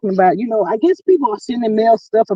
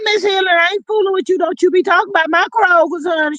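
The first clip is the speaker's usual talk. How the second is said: mock outrage; 8 semitones higher, faster than usual. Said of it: "mock outrage at viewer".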